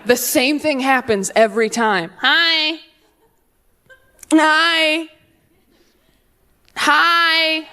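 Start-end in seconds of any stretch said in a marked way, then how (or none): 2.2-7.7 put-on baby saying hi. Baby waving from stroller.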